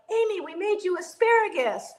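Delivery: high, friendly call across the parking lot; fans calling out. Retold or imitated. Tailgating fans calling to Amy over the crowd, high cheerful call. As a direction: call out a greeting to her, mock fan shout. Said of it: "our fans would yell out".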